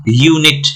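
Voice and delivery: Unit with a long 'yoo' before a short 'nit'; stressed, stretched यू. Shown as a demonstration. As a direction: lean on the first syllable of unit and stretch it. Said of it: "यू पे जोर पड़ रहा है तो वो खींच गया". (Unit में यू पर ज़ोर)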